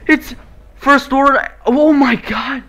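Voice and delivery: high, loud thrilled yelps; blurting out each reveal in joy; excited shouting. Real, not acted. Gamer seeing the trailer reveals, real excited shouts. First watch of Star Wars trailer.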